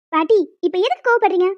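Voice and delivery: ordinary talk, nothing marked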